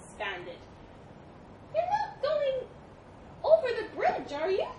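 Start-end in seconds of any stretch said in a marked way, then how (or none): 1.7-4.7 high, loud mouse squeak; anxious little mouse. Acted. Mouse questioning the elephant's route, high and anxious.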